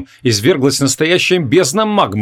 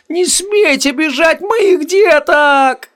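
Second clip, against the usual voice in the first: hysterical scream; 14 semitones higher, much slower than usual. A narrator's reading. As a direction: shriek in panic for his creatures. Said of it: "истерично завопил колдун-гомункул".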